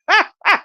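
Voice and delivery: squealing laugh; high burst of laughter. Real, not acted. High-giggling at her own story about the movie. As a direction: let out a loud high laugh.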